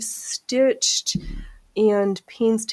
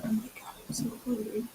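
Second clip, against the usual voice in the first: whispered hungry moan; voicing Phoenix in a breathy whisper, pleading; Phoenix complaining; 14 dB quieter, much faster than usual. Speaking for Phoenix who complains she's hungry, whispered whine. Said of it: "where Phoenix complains she's hungry".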